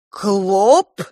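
loud, rising, gasped question; astonished gasp. A narrator's reading. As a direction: gasp it out in disbelief. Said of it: "ахнул Матиас".

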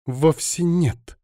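high, slow, husky female denial; wheezed denial. A narrator's reading. Alina cowering under his gaze, wheezing. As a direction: squeeze the denial out. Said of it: "просипела Алина".

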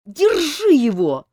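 high, loud, slow shout. A narrator's reading. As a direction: yell for help to hold him. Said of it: "закричала Азиль".

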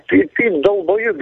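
usual speaking voice

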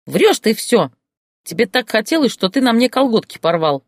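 high, loud shriek of denial. Acted. Oksanka shrieks at Boris, high and loud. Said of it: "завизжала круглая Оксанка".

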